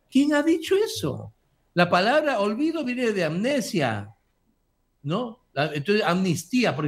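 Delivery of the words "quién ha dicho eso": indignant question. Real, not acted.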